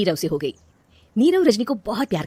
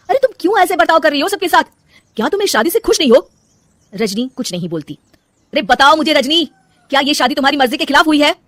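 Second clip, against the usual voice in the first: high, loud pressing questions; husband confronting his unhappy wife; heated demand; 6 semitones higher, 7 dB louder than usual. Acted. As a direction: demand an answer from her.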